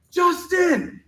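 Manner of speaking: sudden high shout of the name; yelling after a friend on a train. Retold or imitated. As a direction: call out after him in alarm. Friends panicking as Justin leaves.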